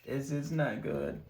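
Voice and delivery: soft, worried repeat; quiet dismay. Real, not acted. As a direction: drop to a worried hush. Dice going badly, repeated.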